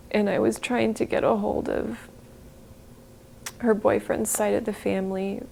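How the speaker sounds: a mother reliving the night her daughter died, weeping; tearful. Real, not acted. Mother recalls hospital breakdown, weeping.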